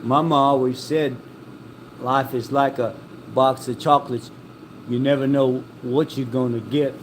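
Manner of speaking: slow, unhurried, with pauses between phrases; slow Forrest delivery. Retold or imitated. Quoting Forrest the way he really says it, slow and drawn out. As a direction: let each phrase hang like Forrest does in the movie. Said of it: "he never says it that fast".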